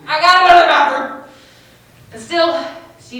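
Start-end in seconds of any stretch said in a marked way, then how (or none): 0.1-1.2 high, loud mock-heroic announcement; dramatic desperation. Kid playing her last card to her friend, high and loud. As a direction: play the last trump card. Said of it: "I'm like".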